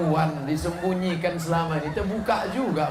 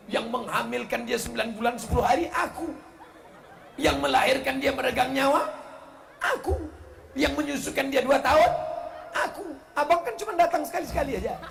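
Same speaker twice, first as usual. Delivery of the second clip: mock indignant wife; 6 semitones higher than usual. Wife angrily listing sacrifices.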